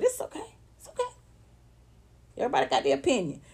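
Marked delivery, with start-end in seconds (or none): 0.0-1.1 high, soft little voice; soft, squeaky mock concession; mock reassurance. Mock-conceding others think themselves superior.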